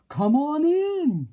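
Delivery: big, loud booming welcome; bellowing wolf. Acted. Wolf host greeting party guests, booming.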